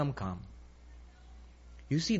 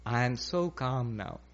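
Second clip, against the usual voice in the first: slow, softer, lower self-satisfied calm; acted calm; 5 semitones lower, 6 dB quieter, slower than usual. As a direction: say it slowly, as if settled in peace. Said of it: "when the mind becomes calm".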